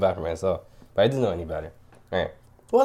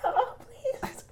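desperate whisper; 6 dB quieter than usual. Retold or imitated. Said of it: "waving desperately".

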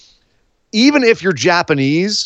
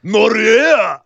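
put-on higher, shouted tough-girl cry; thuggish growled shout; 8 semitones higher than usual. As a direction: bark it out like a street thug. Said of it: "growly voice doing that kinda stuff".

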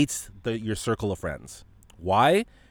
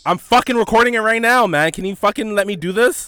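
loud, high, exasperated snap at the interruption; annoyed outburst; 11 semitones higher, 9 dB louder than usual. Real, not acted. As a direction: blow up at the friend for cutting in. Friend interrupts his podcast call.

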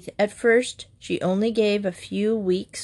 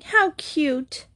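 high-pitched delighted exclamation; delighted cry; 8 semitones higher than usual. A narrator's reading. Betsy clapping as Wag brushes his teeth, delighted cry. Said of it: "cried Betsy".

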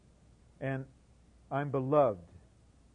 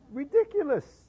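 much higher and louder, scoffing; scornful exclamation; 11 dB louder than usual. Acted. Preacher scoffing at yearning taught in class, mocking cry. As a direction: toss it off as absurd.

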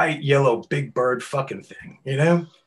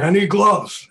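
loud, high-pitched urgent request; raised-voice retold plea; urgent demand; 9 semitones higher, 11 dB louder, slower than usual. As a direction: blurt it out loudly like an emergency. Retells rushing into hardware store.

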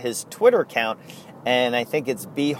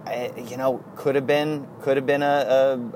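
ordinary talk, nothing marked

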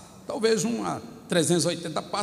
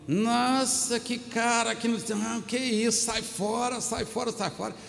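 higher-pitched mock girlfriend voice; disapproving girlfriend; 5 semitones higher, slower than usual. Girlfriend telling her friend to dump the guy, mock disapproval. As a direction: play the friend warning her off.

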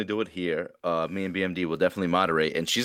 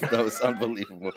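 ordinary talk, nothing marked

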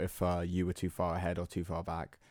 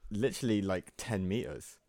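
usual speaking voice

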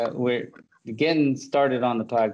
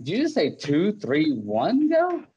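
higher pitch, shooting up on go; incredulous; 6 semitones higher than usual. Retold or imitated. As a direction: question it in mock disbelief. Acting out his disbelieving reaction.

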